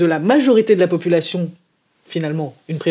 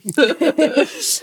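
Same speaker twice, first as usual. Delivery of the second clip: amused laugh; 7 semitones higher than usual. Real, not acted. Laughs at ironic denial.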